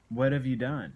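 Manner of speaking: ordinary talk, nothing marked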